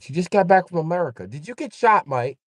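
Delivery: high, loud put-on English voice; hammed-up Brits asking if he got shot; mock British gossip. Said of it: "They're gonna be like".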